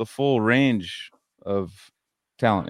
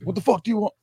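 mock rude clerk; 11 semitones higher, faster than usual. Subway worker demanding the order, high mock impatience. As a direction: fling the question at him, pitched up. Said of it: "yelled at me".

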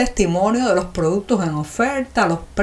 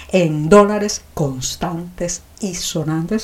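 each word slowed and weighed; slow emphatic; 3 semitones lower, much slower than usual. Real, not acted. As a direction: drag it out for emphasis. Stressing payment only in dollars.

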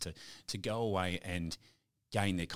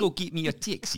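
higher, louder hammed-up New York accent; 10 semitones higher, 6 dB louder than usual. Host joining the joke in a New York accent. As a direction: hail a cab like a brash New Yorker. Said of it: "that's New York, eh?".

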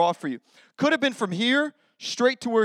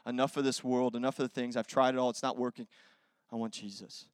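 quiet surrender; 7 semitones lower, 6 dB quieter than usual. Retold or imitated. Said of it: "and says".

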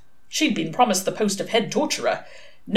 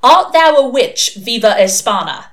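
magisterial challenge; 5 semitones higher, 9 dB louder than usual. A narrator's reading. Chief inquisitor repeating his question to the suspect, magisterial. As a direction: proclaim it with mock authority.

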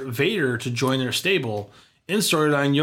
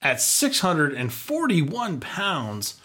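slow announcer read; 3 semitones higher, much slower than usual. Acted. Announcing Yoko's weight dramatically.

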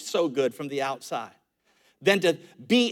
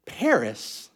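condescending retort; 8 semitones higher than usual. Pretend. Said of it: "what I wanted to say".

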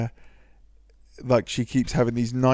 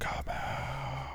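very soft, drawn-in ghostly voice; gasp, then barely voice it, eerily; eerie microphone voice; 14 dB quieter than usual. Retold or imitated. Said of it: "person was on a microphone going".

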